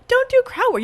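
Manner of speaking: high-pitched mocking quote of a friend; mocking imitation. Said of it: "You were like".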